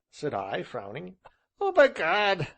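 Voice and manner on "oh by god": high, loud glee; delighted cry. Acted. Gentleman crying out in delight. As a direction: burst out. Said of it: "he cried".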